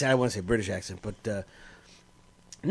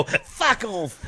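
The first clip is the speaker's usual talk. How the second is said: softer, high imitation Irish accent; Irish bartender; 4 semitones higher, 5 dB quieter than usual. Recalling what the bartender always says, Irish accent. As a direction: echo the Irish barman, pitched up but softer. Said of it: "All I hear is him go".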